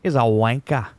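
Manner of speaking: mock Australian accent, lifted and punchy. Shown as a demonstration. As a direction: do a demo Australian accent with dropped R's. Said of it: "I can do, like, an Australian accent".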